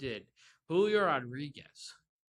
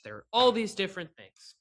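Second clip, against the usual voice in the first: loud, raised, exasperated outburst; exasperated rant; 6 semitones higher, 9 dB louder than usual. Real, not acted. Podcaster giving up on listing the team's faults, exasperated. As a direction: throw it out in frustration. Fed-up rant about the team.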